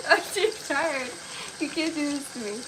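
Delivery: ordinary delivery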